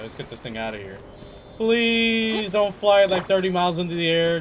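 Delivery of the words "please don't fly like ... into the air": very slow, higher, louder begging; slow pleading. Real, not acted. Player begging the Seelie not to fly off, slow drawn-out plea.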